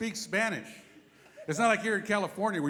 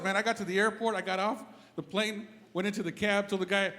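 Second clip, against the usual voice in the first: usual speaking voice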